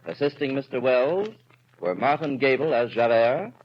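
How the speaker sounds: ordinary delivery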